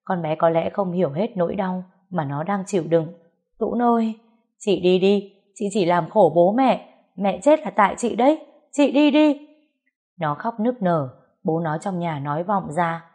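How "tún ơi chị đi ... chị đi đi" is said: loud, higher, peaking on the last words; grieving shout. Acted. Younger sister blaming older sister for mother's death, shouting. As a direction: hurl the blame through tears. Sister keeps screaming at her.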